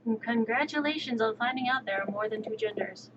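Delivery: ordinary talk, nothing marked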